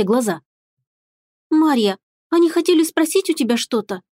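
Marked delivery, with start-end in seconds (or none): 1.5-4.0 put-on high, a little guilty girl; owning up, a bit sheepish. A narrator's reading. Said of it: "немного виновата сказала девочка".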